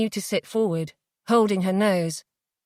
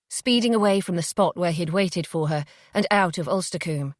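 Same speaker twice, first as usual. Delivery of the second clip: ordinary delivery, nothing marked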